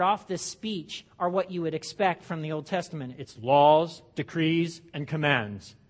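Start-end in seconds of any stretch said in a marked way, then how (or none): 3.2-5.8 big, loud, booming proclamation; deep booming voice. Shown as a demonstration. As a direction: boom it out like a lawgiver. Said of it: "you have to say it in that deep, booming voice".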